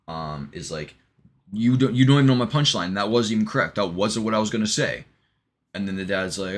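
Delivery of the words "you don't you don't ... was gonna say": loud, higher opening, easing a little; acted outrage at a stolen punchline; indignant protest. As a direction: protest it in mock exasperation. Retold Kyle protests being interrupted.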